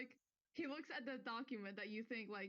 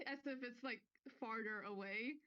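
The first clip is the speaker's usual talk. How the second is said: ordinary talk, nothing marked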